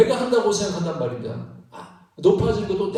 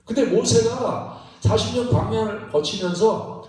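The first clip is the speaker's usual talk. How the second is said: usual speaking voice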